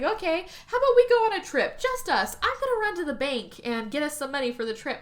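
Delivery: mock-cheerful husband sweeping her off to a trip; nervous eager husband. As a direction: talk her into a trip a bit too eagerly. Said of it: "and he's like".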